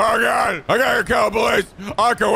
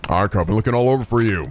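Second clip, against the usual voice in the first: deep, gruff guardian tone; Kiryu voice; 9 semitones lower than usual. Acted. Player voicing Kiryu, lower and gruff to Ayako. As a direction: call her home like a firm uncle.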